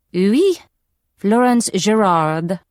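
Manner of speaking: slow, hammed-up French accent; exaggerated French accent. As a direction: lay the French accent on thick. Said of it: "she says in an exaggerated French accent".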